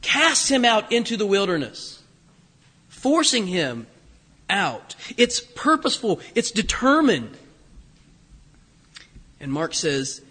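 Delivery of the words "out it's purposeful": usual speaking voice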